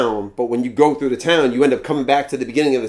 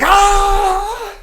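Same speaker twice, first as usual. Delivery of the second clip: high, louder startled scream; mock scream; 5 dB louder than usual. Viewer jolted by a twist, high mock scream. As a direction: yelp as if the show just shocked you. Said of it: "And then you're like".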